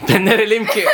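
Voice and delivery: laughing echo of the question. Real, not acted. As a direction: chuckle through the repeated question. Echoing friend's question, laughing.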